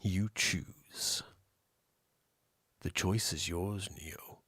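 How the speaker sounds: deep, hushed mentor impression; Morpheus. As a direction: present the choice low and portentously. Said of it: "That's kinda how he sa-, talks".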